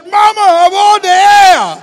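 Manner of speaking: high, loud alarmed yelp; panicked shout. Retold or imitated. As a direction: warn mom she's live on radio. Panicked warning to mother live.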